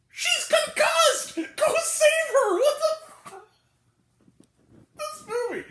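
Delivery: high, loud, drawn-out exasperated yell; incredulous shouting. Real, not acted. Critic ranting at an absurd plot, incredulous high shout. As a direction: shriek it slowly in disbelief, breaking into laughter.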